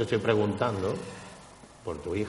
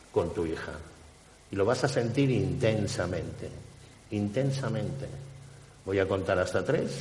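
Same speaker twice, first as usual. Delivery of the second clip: hypnotic suggestion; 5 dB quieter than usual. Acted. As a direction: lull her gently into the memory.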